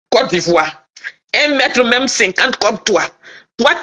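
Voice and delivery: ordinary talk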